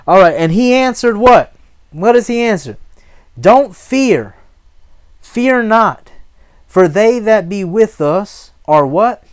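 loud, high, emphatic preacher's call; forceful preaching. Acted. Preacher giving the prophet's answer to fear, forceful preaching. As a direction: proclaim it and prompt the congregation.

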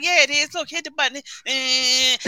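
put-on high-pitched little boy insisting; young son. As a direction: imitate a small child, voice lifted. Young son insisting to his sister.

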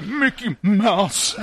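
hammed-up slow dubbed call; imitated wounded character. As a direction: drag it out like a shot man calling his buddy. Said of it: "And then it's like".

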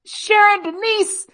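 high, loud, drawn-out parental yell; mock-angry name-calling holler; scolding parent. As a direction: call out the full name like a parent in a fury. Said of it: "when they go".

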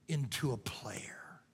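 slow, low whisper. Acted. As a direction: drop to a hush for emphasis. Preacher hushing the point.

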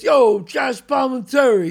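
slow, loud call across the room; shouted greeting. Retold or imitated. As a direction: yell his name out slowly. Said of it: "the guy goes to me".

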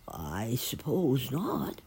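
slow, wavering, drawn-out concession; reluctant admission. A narrator's reading. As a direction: concede it slowly, still unsure. Doubtful sparrow conceding to dove.

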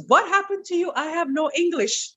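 high, playful Jamaican patois; doctor breaking into patois. Retold or imitated. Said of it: "He goes".

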